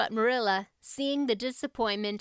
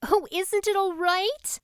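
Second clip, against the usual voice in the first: put-on anxious girl; 10 semitones higher, 5 dB louder than usual. Anne fears the cake failed.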